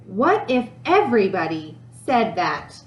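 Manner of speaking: usual speaking voice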